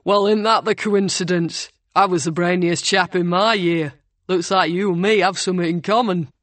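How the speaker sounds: dry mock-boast in a northern Sheffield voice; Sheffield accent. A narrator's reading. Ryan mocks Will's posh boast, dry, in a Sheffield accent. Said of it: "His accent was straight up Sheffield".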